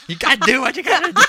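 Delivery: fast, high, laughing shout; excited catchphrase. Acted. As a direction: belt the catchphrase through laughter.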